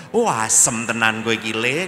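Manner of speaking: loud comic grumble; mock indignant exclamation. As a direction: burst out in joking outrage. Mock-indignant reaction to being used.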